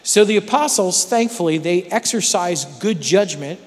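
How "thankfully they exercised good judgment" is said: normal talking voice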